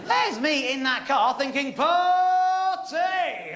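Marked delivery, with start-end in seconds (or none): 1.7-3.6 shouted high and loud; excited party. Retold or imitated. Thinking in the car, excited loud party. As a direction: cheer out party with glee.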